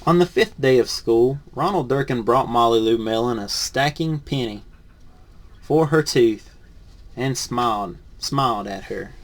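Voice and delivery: lively picture-book read-aloud; reading to children. Acted. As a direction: read it to a class of kids.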